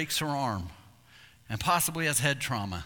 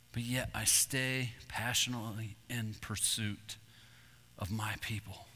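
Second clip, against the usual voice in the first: tender voice of God; 4 semitones lower, 5 dB quieter than usual. Retold or imitated. God answering Arby by the campfire, soft and tender. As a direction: gently speak as God calling his people. God speaking softly to the hunter.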